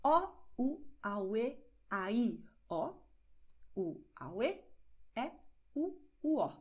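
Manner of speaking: vowel sentence O, u, a, u spelled out slowly, each vowel apart; slow the vowels down so a learner can follow; careful slow vowel demo. Shown as a demonstration. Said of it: "ein bisschen langsamer wiederholen".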